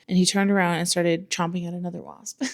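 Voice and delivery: usual speaking voice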